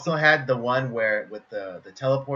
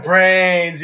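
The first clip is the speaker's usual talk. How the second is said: loud groaning ugh brains; loud hungry zombie; 7 semitones higher, 12 dB louder than usual. Retold or imitated. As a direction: friend chiming in as a zombie. Friend joins zombie imitation.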